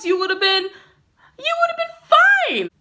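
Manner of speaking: hammed-up indignant manager voice; mock manager outrage. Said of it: "She was like".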